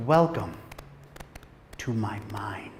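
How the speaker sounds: Anthony Hopkins villain, low and hushed; villain line. Retold or imitated. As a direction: purr it low like a creepy mastermind. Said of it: "and he goes".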